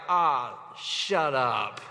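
low, slow scoff; dismissive. Retold or imitated. Zechariah scoffing at the angel, low and slow. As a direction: brush the angel off with a lazy, low grumble. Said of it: "And Zach's like".

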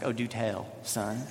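low, quiet and dry, lightly teasing; dry mock interest. Dad mock-humoring his nine-year-old son, low and dry. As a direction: humor the kid with mock curiosity. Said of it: "And I was like".

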